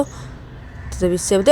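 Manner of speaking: usual speaking voice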